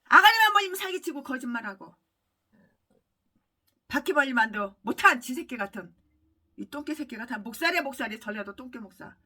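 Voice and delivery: ordinary talk, nothing marked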